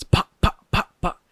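loud, sharp mock gunshot pops; mouth-made gunshot pops; imitated gunshots. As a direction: pop it out like shots in the distance. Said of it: "you hear gunshots".

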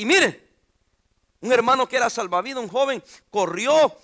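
normal talking voice

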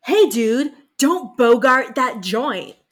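drawn-out, loud stoner call; slow, loud stoner drawl; loud stoner quote. Retold or imitated. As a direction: bellow it like a lazy stoner. Quoting stoner line loudly.

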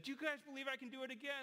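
high, fast showman question; eager showman. Retold or imitated. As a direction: rattle it off to the crowd. Performer challenges the crowd.

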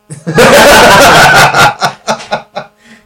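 big, high, helpless laughter; loud laugh. Real, not acted. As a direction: roar with laughter. Laughs at accent denial.

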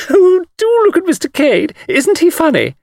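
very high, loud delighted squeal; screaming with mirth. A narrator's reading. Miss Taylor pointing out funny Mr Cade, shrieking. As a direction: shriek it in helpless amusement. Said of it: "screamed with laughter".

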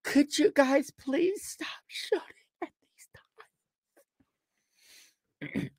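tiny high voice fading away; hammed-up meek begging; mock timid plea. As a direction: plead feebly, high, trailing off to almost nothing.

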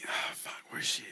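whispered panicked curse; whispered, half panicked. Acted. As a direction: breathe it out in alarm. Moetsi realizes she's gone.

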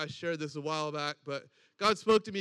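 ordinary talk, nothing marked

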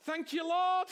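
rapturous praise; joyful yes. Shown as a demonstration. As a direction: shout yes to the Lord. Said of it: "just say".

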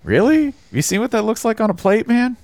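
mock disbelief, pitched high; incredulous critic. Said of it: "critique where they're like".

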